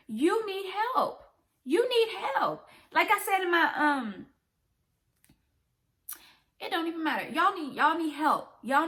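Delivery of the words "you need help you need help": higher, slow stern insistence; emphatic plea. Real, not acted. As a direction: drag it out, pleading firmly.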